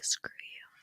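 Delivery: barely voiced, just breathing the words; mouthed whisper. [name] mouthing an insult at Rob across the fire pit, whispered. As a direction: mouth it silently so only he catches it. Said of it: "She mouths it".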